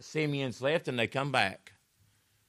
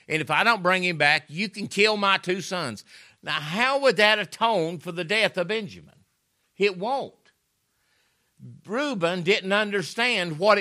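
loud, higher pitched sermon climax; fiery preaching; 5 semitones higher, 7 dB louder than usual. Acted. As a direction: preach it hard to the congregation. Preaching at full force.

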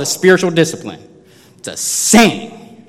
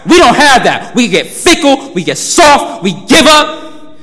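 louder, higher preaching that swells to a drawn-out close; preaching at full force; 8 semitones higher, 7 dB louder, slower than usual. Acted. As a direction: preach it out, building to the end. Preacher building to a peak.

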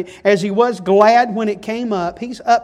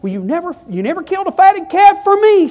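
bitter retort rising higher and louder; resentful complaint; 11 semitones higher, 8 dB louder than usual. Acted. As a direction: throw the grievance back at the father. Resentful elder brother answers father.

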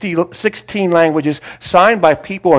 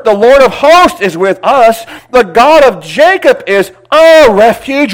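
forceful scripture reading; 8 semitones higher, 6 dB louder than usual. Acted. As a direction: declare each line of the psalm. Rereads psalm with force.

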